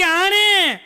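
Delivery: high, slow, drawn-out blaming whine; mock wife's high-pitched accusation; hammed-up wifely complaint. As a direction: blame the husband loudly, stretching each word. Wife blames husband before the neighbours.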